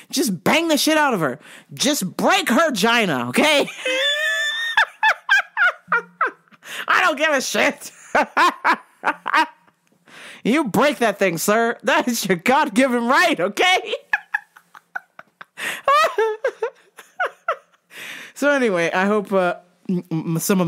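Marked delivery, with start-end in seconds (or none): 3.7-6.3 high shrieking cackle; shrieking laugh. Real, not acted. Laughing at own joke. 15.4-16.7 squealing laugh, much higher; high giggle. Real, not acted. Laughing at own joke.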